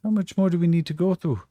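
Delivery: high, whiny and exasperated; exasperated whine. Acted. Jean-Francois complains to Yakim, whiny French exasperation. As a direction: groan it like he's sick of the topic. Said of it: "And Jean-Francois just".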